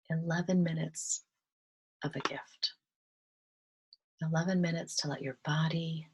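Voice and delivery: unhurried, even-toned calm, phrase by phrase; guided meditation. Real, not acted. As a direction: ease them gently into relaxation, leaving space to breathe.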